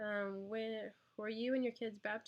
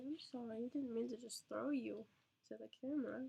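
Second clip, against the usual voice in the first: usual speaking voice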